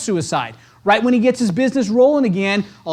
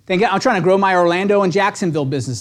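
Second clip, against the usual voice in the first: usual speaking voice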